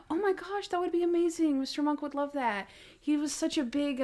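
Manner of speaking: high-pitched gushing imitation; hammed-up sweet enthusiasm; mock delighted. Acts Natalie delighted at song offer.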